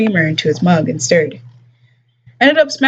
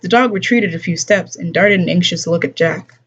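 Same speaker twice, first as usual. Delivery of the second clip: ordinary delivery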